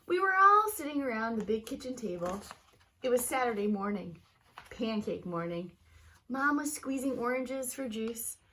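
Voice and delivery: lively, expressive storybook reading; reading to children. Acted. Teacher reading a picture book aloud to her students, lively storybook voice. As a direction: read it like story time for a class.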